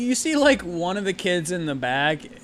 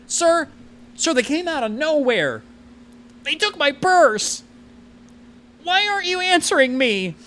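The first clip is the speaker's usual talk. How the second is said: put-on distressed old lady; 12 semitones higher, 5 dB louder than usual. Confused old lady pleading into a makeup compact, high distressed wailing. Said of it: "you hear an old lady".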